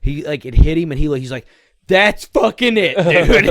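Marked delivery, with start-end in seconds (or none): 1.9-3.4 much higher, louder enraged shout; furious yell. Retold or imitated. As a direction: scream it as you charge the mound. Kid charges the mound.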